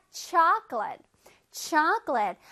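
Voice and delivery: chocolate as two beats, CHAHK-lit; English pronunciation. Shown as a demonstration. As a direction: open first vowel on chocolate, swallow the middle. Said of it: "이라고 발음하기로 했지요".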